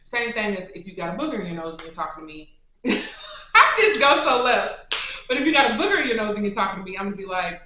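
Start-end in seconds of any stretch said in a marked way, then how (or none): 2.8-3.5 a quick squeal of laughter; short high laugh. Real, not acted. Vlogger laughing at her booger joke, squealing laugh.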